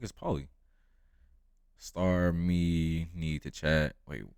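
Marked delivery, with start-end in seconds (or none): 1.8-3.9 slow, loud Jamaican patois; Jamaican accent. Retold or imitated. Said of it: "He said in his thick Jamaican accent".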